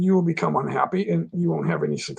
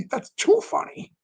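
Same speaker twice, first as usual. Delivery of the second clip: amused disbelief. Retold or imitated. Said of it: "And I was like".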